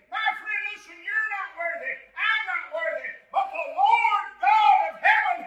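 shouted, high-pitched revival preaching; full-force preaching. Acted.

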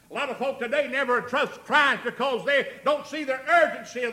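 loud, high-pitched pulpit exhortation; full-force preaching. Real, not acted. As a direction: drive each phrase home to the congregation.

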